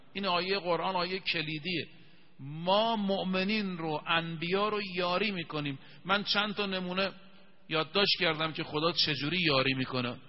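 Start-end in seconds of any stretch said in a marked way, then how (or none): none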